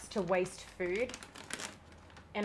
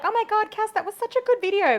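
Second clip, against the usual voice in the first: high mock-gushing fan voice; gushing commenter; 13 semitones higher, 8 dB louder, faster than usual. Mocking fans who comment without watching, in a high gushing voice. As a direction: ham up a fake adoring comment, quickly. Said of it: "comment and be like".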